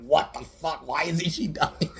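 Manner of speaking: high-pitched mock disbelief; imitated bafflement. Killer with cleaver at an undying victim, mock baffled. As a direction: voice the killer's exasperated confusion.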